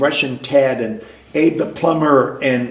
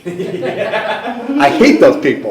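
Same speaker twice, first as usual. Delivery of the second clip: laughing; 7 semitones higher, 4 dB louder, much slower than usual. Real, not acted. Laughing at a knowing friend.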